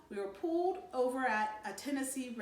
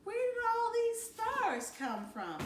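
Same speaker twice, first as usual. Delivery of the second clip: high, slow, wondering little voice; half-sung in awe and fear; real scared; 13 semitones higher, slower than usual. Acted. Little Joey asking Mommy about the stars, scared and high. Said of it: "sounding real scared".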